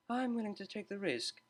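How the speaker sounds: soft, dry mutter. A narrator's reading. Cellist replying to the DJ, soft dry mutter. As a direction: mumble it under your breath. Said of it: "muttered the cellist".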